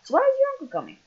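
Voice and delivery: squeaky high voice, loud; high-pitched voice. Acted. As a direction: pitch it way up like a cartoon kid. Voicing game character question.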